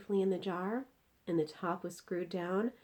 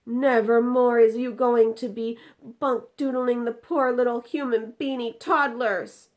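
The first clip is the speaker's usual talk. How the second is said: loud, stern giant's rebuke; scolding giant; 7 semitones higher, 12 dB louder than usual. Acted. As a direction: tell the beastie off. BFG scolding the dream.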